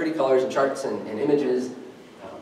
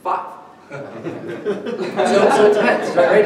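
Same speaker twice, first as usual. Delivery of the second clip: laughing; 6 semitones higher, 5 dB louder, much slower than usual. Real, not acted. Laughing at Coinbase's five tokens.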